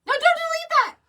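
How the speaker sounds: sudden loud high cry of alarm; alarmed shout. Real, not acted. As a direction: yell it out to stop them. Co-host about to delete text.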